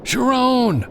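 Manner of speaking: high, loud call over the wind; calling out against a roaring wind; shouting over wind. A narrator's reading. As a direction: yell her name across the gale. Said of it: "he shouted over the whistle of the wind".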